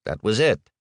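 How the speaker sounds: normal talking voice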